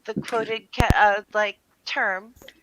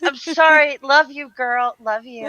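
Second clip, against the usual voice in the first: ordinary talk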